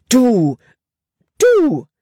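hoch und lauter, vor Wut stotternd; wütend sprühend. Acted. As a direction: spuck die Worte empört aus. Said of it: "sprühte sie". (Libby faucht Caleb an, wütend und höher)

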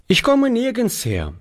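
put-on high boy's voice, easy and unbothered; calm boy. A narrator's reading. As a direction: shrug it off as the boy. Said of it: "antwortete dieser gelassen".

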